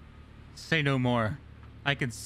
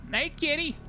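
cutesy cat voice; 11 semitones higher than usual. Real, not acted. Player greeting game cat, high cutesy coo. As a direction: coo at the kitty.

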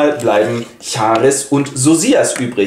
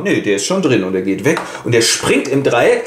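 normal talking voice

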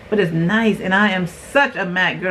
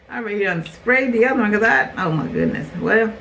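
normal talking voice

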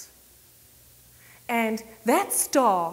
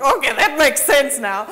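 loud, amused realization; knowing realization; 4 semitones higher, 10 dB louder than usual. Retold or imitated. Realizing why he paid, loud amused realization. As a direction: land it loudly, all suddenly clear. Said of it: "I was like".

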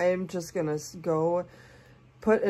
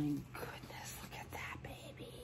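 awed whisper; 3 semitones lower, 16 dB quieter than usual. Real, not acted. Unveiling the baby's face, an awed whisper. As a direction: whisper in wonder at first sight. Taking the doll in.

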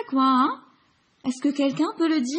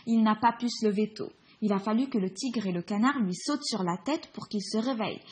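ordinary delivery

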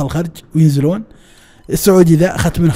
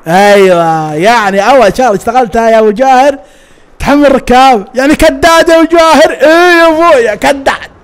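high, loud mocking outburst; mock exclamation; 7 semitones higher, 4 dB louder than usual. Joker teasing his friend about touting for passengers, loud mocking. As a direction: jeer in raised pitch, breaking toward laughter.

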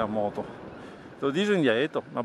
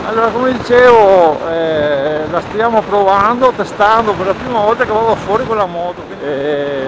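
loud over wind; 3 semitones higher, 10 dB louder than usual. Real, not acted. Talking while riding in wind.

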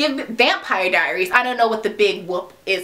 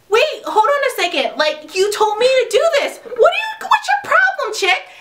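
high, laughing disbelief; 13 semitones higher than usual. Retold or imitated. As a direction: protest in high bafflement.

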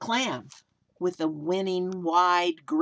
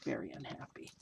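very soft, lower whispered echo; mock sad whisper; 5 semitones lower, 14 dB quieter, faster than usual. A narrator's reading. Storyteller to kids, whispering the sad face. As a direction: breathe it out like a secret.

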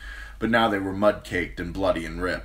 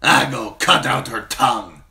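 loud, higher shouted command; furious order; 7 semitones higher, 10 dB louder than usual. Acted. Rider shouts to cut out her tongue, loud savage order.

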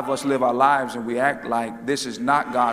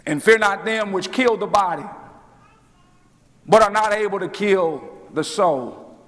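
preaching; 9 semitones higher, 4 dB louder than usual. Real, not acted. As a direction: preach it at full force, stretching the big words. Preaches at full force.

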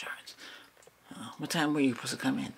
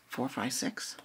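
ordinary delivery

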